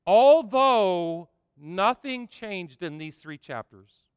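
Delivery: slow, loud reading with "Although" stressed; "Although nothing changed" preached slowly; emphatic reading. Shown as a demonstration. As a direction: draw out "Although" slowly and loudly. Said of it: "say that word with me".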